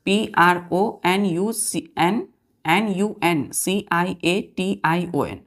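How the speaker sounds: letters spelt out slowly one by one; slow spelling. Shown as a demonstration. Teacher spells the word out slowly for students. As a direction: dictate each letter slowly and clearly. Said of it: "क्या है spelling बच्चों?".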